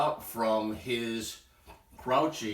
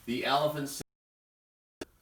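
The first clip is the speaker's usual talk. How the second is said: ordinary delivery, nothing marked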